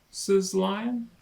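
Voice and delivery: ordinary delivery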